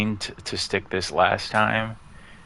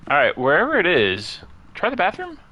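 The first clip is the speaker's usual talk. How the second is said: much higher squeaky voice telling himself what to do; a high, silly self-instruction; mock inner voice; 10 semitones higher than usual. Advising himself where the ghost hides, high mock voice. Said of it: "He tells himself".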